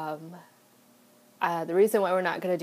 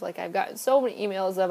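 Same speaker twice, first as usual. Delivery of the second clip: usual speaking voice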